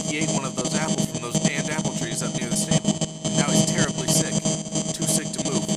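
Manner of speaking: high voice pleading over a tinny radio broadcast; a stranded parent begging the airwaves for rescue, worn down; resigned radio plea. Acted. Stranded pony broadcasting to anyone, high tinny resigned plea. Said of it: "A voice was filled with a terrible resignation".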